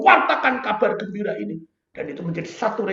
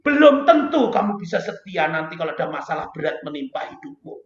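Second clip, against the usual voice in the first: usual speaking voice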